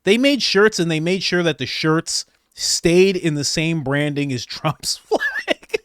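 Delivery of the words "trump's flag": high laugh taking over the words; breaking into laughter. Real, not acted. Host on the shirts copying Trump's branding, breaking into laughter. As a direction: crack up as you finish the phrase.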